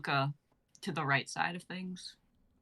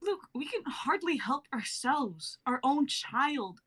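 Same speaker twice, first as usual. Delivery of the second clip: bitter wife; 9 semitones higher than usual. Acted. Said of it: "Tony being played by [name]".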